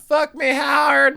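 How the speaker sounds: high, loud imitation of a woman crying out; mock yell. Pearl calls to Howard in bed, imitated as a loud yell. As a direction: yell it out at the top, hammed-up. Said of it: "we get Pearl yelling out".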